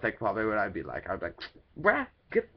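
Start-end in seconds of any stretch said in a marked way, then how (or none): 1.8-2.5 much higher, mocking disgust; a mocking higher clone saying bruh; inner clone voice. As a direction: tease yourself in a squeaky sidekick voice. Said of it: "a clone of me that was, like, my inner thoughts".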